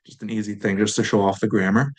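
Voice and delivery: ordinary talk, nothing marked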